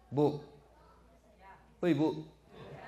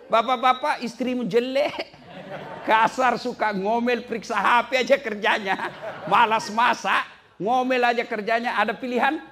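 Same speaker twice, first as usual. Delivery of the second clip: high, loud, laughing rant; laughing tease; 10 semitones higher, 7 dB louder than usual. Acted. Preacher joking to husbands about nagging wives, loud and laughing. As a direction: rile up the husbands with a grin.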